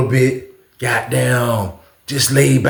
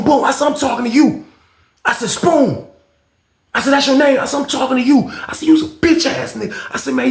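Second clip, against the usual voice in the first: furious yelling; 11 semitones higher than usual. Retold or imitated. Cussing out the neighbor who flooded his cell, shouting.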